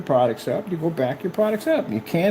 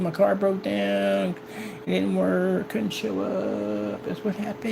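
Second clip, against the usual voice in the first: softer, slow whiny excuse voice; mock excuses; 4 semitones higher, 6 dB quieter, slower than usual. Mocking the rivals' excuses, soft and slow. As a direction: mimic lame excuses. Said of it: "Make excuses".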